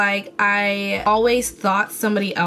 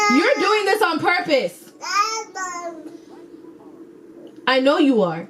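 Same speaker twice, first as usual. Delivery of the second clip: exasperated; 8 semitones higher than usual. Real, not acted. Scolding son for noise.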